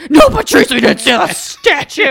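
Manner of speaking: loud, high exasperated retort; fed-up dad snapping back. Retold or imitated. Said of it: "And he's like".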